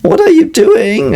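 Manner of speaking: high, slow, demanding wife; wife's voice. Retold or imitated. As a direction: imitate the nagging wife asking. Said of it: "the wife comes and is like".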